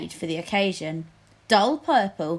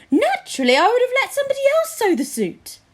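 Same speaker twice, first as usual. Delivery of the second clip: much higher, louder fussy king voice; panting king; 6 dB louder, faster than usual. Acted. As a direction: gasp it out as the servants heave. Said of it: "panted Fred".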